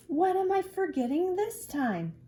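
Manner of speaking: high, loud, worried question; fretting child. Acted. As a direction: rack your brain in a kid's voice. Drew racking his brain.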